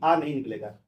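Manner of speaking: ordinary talk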